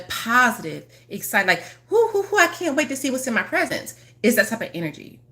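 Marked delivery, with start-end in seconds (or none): 1.9-3.9 high squealing whoos, then a breathless eager line; giddy kid excitement. Acted. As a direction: bounce like a kid on Christmas Eve. Acting a kid before Christmas.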